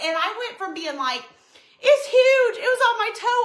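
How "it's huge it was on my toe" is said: high-pitched, loud frantic report; re-enacted fright. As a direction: act out panicked explaining. Retells frantic report to husband.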